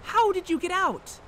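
high, loud stunned questions; can't believe they got out; shocked disbelief. Acted. Robin to Faith on the phone, shocked and disbelieving.

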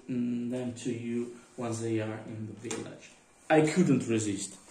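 normal talking voice

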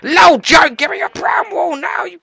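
high, loud shout; shouted demand. Pretend. Player demanding brown wool from a sheep, shouting high. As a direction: yell the order at full tilt.

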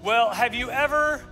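usual speaking voice

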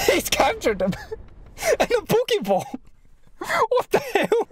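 high laughing through the words; laughing helplessly at the absurd scene; disbelieving laughter. Real, not acted. Reacting to Naruto caught in a Pokeball, laughing.

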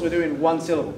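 ordinary talk, nothing marked

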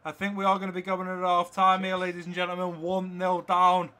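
loud, projected football commentary; live play-by-play. Real, not acted. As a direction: call the match as it happens, peaking at the goal. Live match commentary over gameplay.

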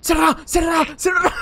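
high loud repeated yell; yelling at a teammate to close the door; urgent shout. Real, not acted. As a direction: scream at him to shut it. Yells to shut the door.

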